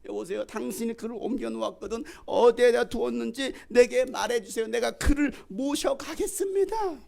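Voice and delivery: high, insistent plea, rising to the end; pleading Mary. Acted. Mary begging the supposed gardener for the body, high urgent plea. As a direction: beg with mounting urgency in a high voice. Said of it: "마리아는 그가 동산지기인 줄 알고".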